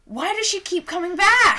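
high, loud, laughing mock outrage; mock dramatic shout. Acted jealous-ex bit.

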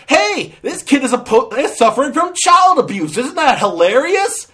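high, loud sarcastic pitch; hammed-up, high-voiced incredulity; mock hilarity. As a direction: sell it as a joke, appalled underneath. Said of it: "They're basically saying".

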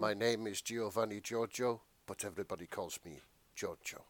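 quoting the meme in a low, soft murmur; a quiet, low deadpan recital of the meme line; low, hushed meme voice; meme quote. Retold or imitated. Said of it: "a reference to that meme".

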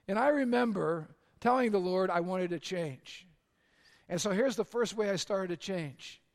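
normal talking voice

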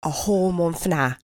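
put-on teasing Manchester English accent. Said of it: "I have to have a Manchester, England accent".

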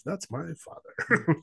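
high, playful reveal that softens at the end; daughter's witty comeback, imitated lightly; wry deadpan reveal. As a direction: tease it out like a punchline. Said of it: "she's funny in the document".

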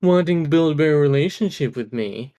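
mock him by parroting it back. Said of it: "The last part he said in a mocking tone".

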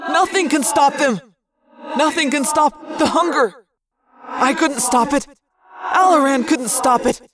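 high, loud, tormented creature; anguished outburst. Acted. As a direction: cry it out as a desperate confession. Wounded creature's anguished outburst and confession.

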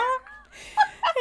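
a squealing laugh that shoots up high; high burst of laughter. Real, not acted. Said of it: "and he like catches her".

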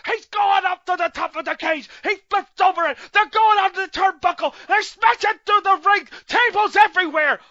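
loud, high, frantic play-by-play; excited commentator. Retold or imitated. Mocking the Spanish commentator's frantic call. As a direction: hype every move like a wild announcer. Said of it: "'cause, like, Morales, like".

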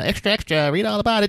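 put-on high old newsreel hawker; newsboy call. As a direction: shout it like a paperboy. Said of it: "like the old newsreels".

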